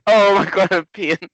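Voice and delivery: loud through helpless laughter; cracking up, barely getting words out; laughing. Real, not acted. Helpless laughing fit while reading.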